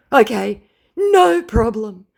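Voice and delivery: high, slow voiced scammer resigned; mock fatalistic scammer. Scammer caving, voiced high and slow. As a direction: give up with a sigh in his voice. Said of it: "he states fatalistically".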